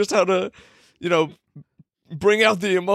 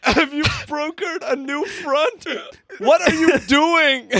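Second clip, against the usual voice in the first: slow, higher-pitched disbelief through laughter; incredulous exasperation; 4 semitones higher, slower than usual. Real, not acted. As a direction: demand an explanation, half laughing. Mock outrage at friend's story.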